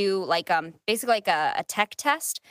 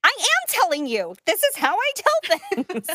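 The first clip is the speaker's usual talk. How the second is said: own voice retold; 11 semitones higher, 4 dB louder than usual. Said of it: "And I'm like".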